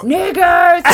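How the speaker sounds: high, stretched-out imitation yell. Repeating a passer-by's outburst for friends, yelling. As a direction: holler it out like that man. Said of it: "he just yelled out".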